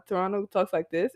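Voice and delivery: "this" lifted at the end like a question; question-like upswing. Shown as a demonstration. Showing friends how Toronto talks, "this" rising questioningly. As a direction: make a statement rise on "this" as if asking. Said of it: "It goes up like it's a question".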